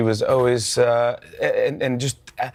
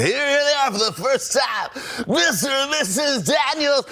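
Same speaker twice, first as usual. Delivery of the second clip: much higher, slow shouted announcement; 13 semitones higher than usual. Retold or imitated. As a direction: boom it out like a wedding emcee. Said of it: "He goes".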